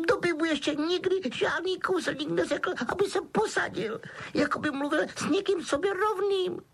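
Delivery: very high wailing elf; Dobby choked up. A narrator's reading. Elf never treated as an equal, wailing. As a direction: keep it squealing, overcome with emotion. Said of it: "zajíkal se skřítek".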